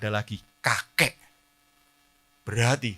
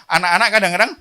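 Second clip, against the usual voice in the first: calling out; 9 semitones higher, 14 dB louder, faster than usual. Shown as a demonstration. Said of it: "saya mau panggil ya".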